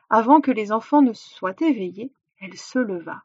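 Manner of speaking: ordinary delivery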